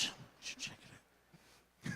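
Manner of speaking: whispered repeat of the tip. Shown as a demonstration. Comedian whispering the fake tip again to the crowd. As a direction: breathe it out as an aside.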